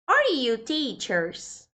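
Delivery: slow, careful English example; slow model sentence. Shown as a demonstration. Teacher reads the English example slowly to students. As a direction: spell it out slowly for learners.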